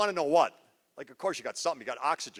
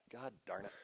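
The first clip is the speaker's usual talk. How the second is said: very soft grumble at the clicker; muttered frustration; 7 semitones lower, 16 dB quieter than usual. Real, not acted. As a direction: curse quietly, annoyed. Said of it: "I swear I'm gonna get this".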